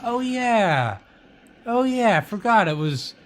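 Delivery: high "oh yeah" of dawning memory; lightly voiced forgetful news reader; mock recall. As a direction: act out suddenly remembering. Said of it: "you're like".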